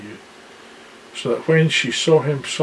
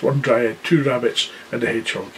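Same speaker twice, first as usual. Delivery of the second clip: plain reading voice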